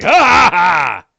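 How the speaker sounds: loud, slow, high cackle; big mocking "ha, ha, ha"; orc's villain laugh. Game master voices the attacking orc, loud mocking laugh. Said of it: "And he's like".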